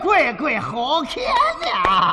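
loud, high, gushing Yangzhou accent; effusive Yangzhou dialect. Shown as a demonstration. Comic showing how warm Yangzhou praise sounds, effusive Yangzhou dialect. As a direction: gush playfully in Yangzhou dialect, stretching the end. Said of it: "扬州话一说就这味儿了".

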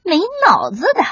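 sly weasel gloating to himself; crafty, self-satisfied villain aside; sneaky sniggering. Acted. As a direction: gloat privately over a trick that worked. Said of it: "黄鼠狼边偷笑边往回跑".

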